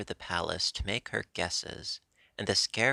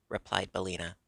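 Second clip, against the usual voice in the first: ordinary delivery, nothing marked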